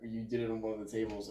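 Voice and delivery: ordinary talk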